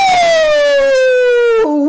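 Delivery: very high, loud, long "woo"; excited whoop. Acted. Host hypes the call count.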